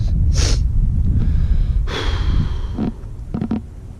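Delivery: long unvoiced heavy sigh; long shaky sigh. Real, not acted. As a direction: let out a long grieving sigh. Upset vlogger between sobs.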